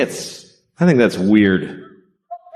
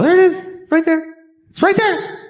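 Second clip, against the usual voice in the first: much higher, sing-song pet talk; talking to a dog. Acted. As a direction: coax the dog toward the ball in a very high voice. Pointing the ball out to the dog.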